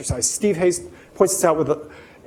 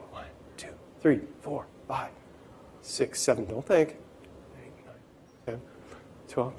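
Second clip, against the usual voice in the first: quiet counting; 5 semitones lower, 14 dB quieter than usual. Real, not acted. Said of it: "counting quietly during exercise".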